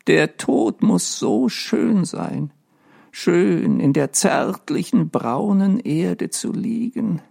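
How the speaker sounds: high slow dreamy ghost, almost in love with death; longing ghost. A narrator's reading.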